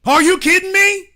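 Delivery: high and loud in disbelief; indignant outburst. Real, not acted. Ranting at the audience in high loud outrage. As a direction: fling it out, outraged. Ranting about Jamaican beach ownership.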